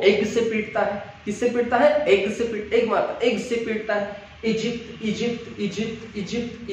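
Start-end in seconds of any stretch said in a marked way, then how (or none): 4.5-6.7 "Egypt" over and over, softer and higher, evenly spaced; repeated drill. Shown as a demonstration. Teacher repeating Egypt so pupils link the sound, repeated drill. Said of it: "Egypt को अगर हम बार-बार repeat करेंगे".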